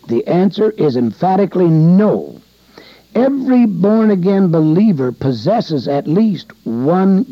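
full-force preaching at the pulpit; emphatic preaching. Acted. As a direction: drive it home to the congregation.

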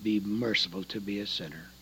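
a soft, low plea for mercy; quiet plea. Retold or imitated. Converted women praying in the quiet evening, hushed plea. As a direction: murmur it like a humble prayer. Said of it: "they cried out".

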